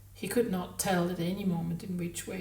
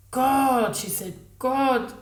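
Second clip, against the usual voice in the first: high, loud, incredulous exclamations; a woman floored by something ridiculous; utterly floored; 4 semitones higher, 9 dB louder than usual. A narrator's reading. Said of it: "She seemed utterly floored".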